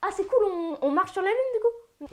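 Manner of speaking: high, eager put-on voice; excited astronaut. Astronaut hearing the news, high eager mock excitement. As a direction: get your hopes way up.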